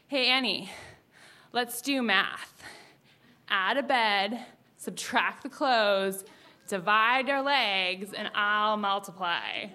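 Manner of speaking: slow mock pickup-line delivery; imitating a boy's smooth line. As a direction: deal out each phrase slowly, like a come-on. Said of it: "him telling dirty pickup lines".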